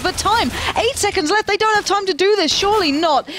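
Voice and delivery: rising, urgent play-by-play; excited. Real, not acted. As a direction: build the tension of the clock. Tense clutch moment in commentary.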